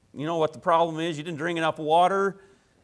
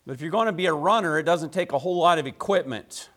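ordinary talk, nothing marked

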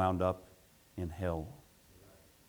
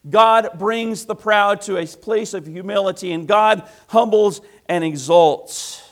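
loud, high and slow proclamation; preaching at full force; 9 semitones higher, 12 dB louder than usual. Acted. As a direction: drive it home, slowly. Preacher building.

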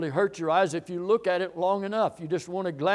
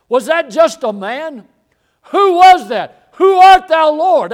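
loud, insistent rhetorical questions; full-force preaching; 3 semitones higher, 12 dB louder than usual. Real, not acted. Preacher pressing the congregation on who Jesus is, full force. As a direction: preach it out hard, building higher and louder.

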